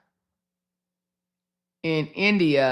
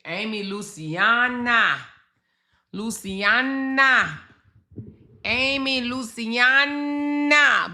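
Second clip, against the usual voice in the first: stretched, rising calls of the name, each higher; drawn-out name call; 6 semitones higher, slower than usual. Real, not acted. Streamer slowly calling out a name to chat, stretched and climbing.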